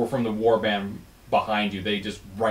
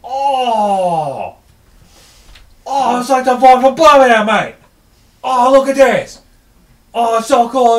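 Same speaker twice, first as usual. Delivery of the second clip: very high, very loud British yobs; awestruck barbarians; 13 semitones higher, 12 dB louder than usual. Acted. Barbarians gawping at the booming voice, loud awe. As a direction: shout in hammed-up amazement. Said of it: "so there's like".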